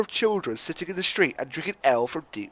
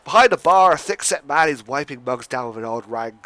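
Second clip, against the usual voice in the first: ordinary talk, nothing marked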